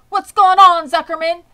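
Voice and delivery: startled announcer shout; alarmed loudspeaker. A narrator's reading. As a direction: call out in alarm across the fair. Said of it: "asked the loudspeaker".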